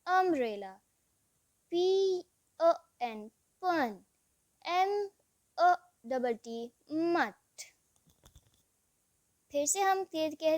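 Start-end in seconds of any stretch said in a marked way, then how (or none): 1.7-6.7 slow, careful letter-by-letter spelling for beginners. Shown as a demonstration. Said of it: "यू को pronounce किया जाता है as a short vowel अ".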